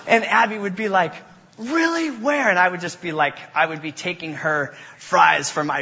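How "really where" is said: high-pitched, eager mock-child questions; gullible little sister. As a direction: imitate an excited, easily fooled little sister. Gullible little sister answers brother.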